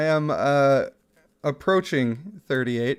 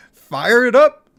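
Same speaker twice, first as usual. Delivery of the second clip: hyped; 9 semitones higher, 9 dB louder, slower than usual. Pretend. Reading hype chat message.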